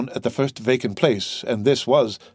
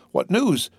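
exclaiming; 8 semitones higher than usual. A narrator's reading. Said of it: "exclaimed a general burst of voices".

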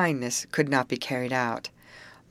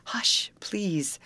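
whispered, pleading hush; gentle begging; 5 semitones higher than usual. A narrator's reading. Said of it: "begged gentle Amy".

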